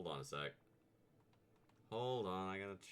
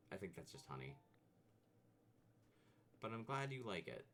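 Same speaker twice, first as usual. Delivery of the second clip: ordinary delivery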